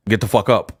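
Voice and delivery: low, unexcited order; cool masculine. Shown as a demonstration. Showing what he'd tell a fallen uncle, cool and low. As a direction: keep it low and cool, no yelling. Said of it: "that's how I would say".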